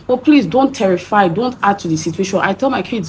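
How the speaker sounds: ordinary talk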